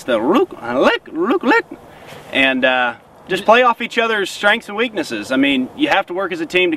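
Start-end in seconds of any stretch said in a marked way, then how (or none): 0.0-1.6 voiced goose rook and lick calls; goose honk. Shown as a demonstration. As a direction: mimic a goose pair calling back and forth. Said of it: "just like you hear geese".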